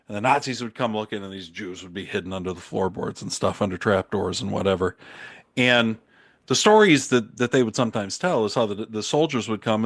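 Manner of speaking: normal talking voice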